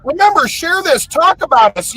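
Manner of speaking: ordinary talk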